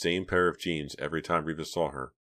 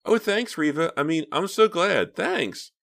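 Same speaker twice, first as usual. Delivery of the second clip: put-on much higher, girlish and gushing; a sweet little girl thanking her friend; 13 semitones higher, 6 dB louder, slower than usual. A narrator's reading. Lisa on the phone thanking Reva, little girl voice. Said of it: "Lisa's little girl voice".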